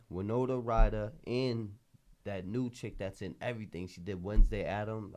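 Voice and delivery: usual speaking voice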